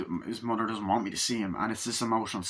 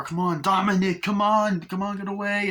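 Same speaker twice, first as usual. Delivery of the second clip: higher, louder urgent calling; mock panicked yell; 10 semitones higher, 5 dB louder than usual. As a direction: hurry the kid away in a hammed-up yell. Retold panic in the fire.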